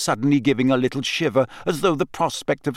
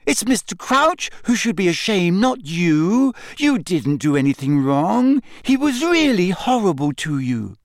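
higher-pitched, insistent reassurance; earnest protest; 8 semitones higher than usual. Acted. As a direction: argue her out of her shame. Hermione protests on Winky's behalf.

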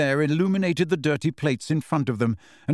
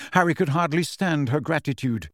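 plain reading voice

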